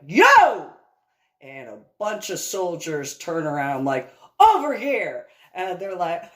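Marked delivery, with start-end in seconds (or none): 0.1-0.7 loud, high call across a crowd; yell. Retold or imitated. Calling soldiers over at the bounce house, loud yell. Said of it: "I yell out". 4.4-5.3 loud, high command; shouted call. Retold or imitated. Calling soldiers over to the ropes, shouting.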